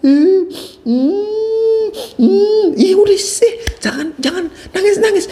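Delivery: put-on high whiny little kid; child's voice. Child hushing themselves in a high little voice. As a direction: sniffle and plead like a small child. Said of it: "Anak mulai bilang".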